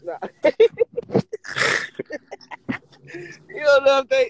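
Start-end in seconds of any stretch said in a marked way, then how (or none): 0.2-2.8 high burst of laughter; cracking up; loud laugh. Real, not acted. Big laugh at friend.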